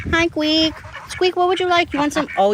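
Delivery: high-pitched greeting to an animal; pet-talk. Real, not acted. Owner asking Squeak what he would like, high pet-talk. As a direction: chat to a pet, voice pitched right up.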